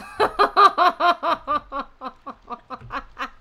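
loud laughter slowly running out; long laughing fit. Real, not acted. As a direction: laugh helplessly until out of air. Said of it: "I'm laughing mostly at".

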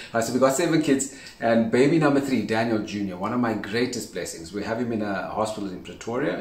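normal talking voice

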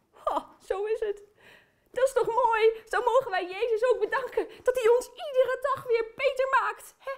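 ordinary talk

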